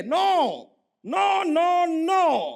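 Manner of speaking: loud firm refusal; emphatic no. Acted. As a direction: shout no, no, no. (ज़ोर से मना करते हुए)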